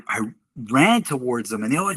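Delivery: ordinary talk, nothing marked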